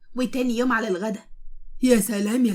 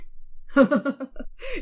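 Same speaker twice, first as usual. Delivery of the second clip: laugh; 3 semitones higher than usual. Acted. Narrator laughs at friend.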